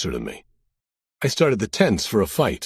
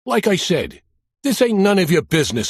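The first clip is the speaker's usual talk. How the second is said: higher, louder Brooklyn wiseguy repeating himself; Brooklyn accent; 8 semitones higher, 4 dB louder than usual. Acted. Mobster repeats his warning to the cop, thick Brooklyn accent. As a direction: push the Brooklyn accent, impatient. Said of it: "with a thick Brooklyn accent".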